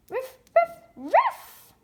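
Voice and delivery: high, loud yappy puppy bark. Pretend. Toy puppy barking at its turn, high and loud. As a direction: bark like a tiny dog. Voicing the dog.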